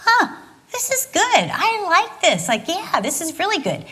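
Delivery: lap up the praise; mock smug delight; pleased reaction. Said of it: "I'm sitting on the stool like".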